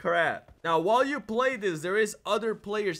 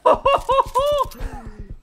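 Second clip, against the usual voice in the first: high laugh; 7 dB louder than usual. Real, not acted. High laugh amid chaos.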